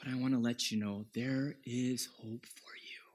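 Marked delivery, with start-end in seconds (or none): 1.1-3.0 soft unvoiced whisper of hope; whispered reassurance. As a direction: lean in and whisper the promise. Preacher whispers comfort to listener.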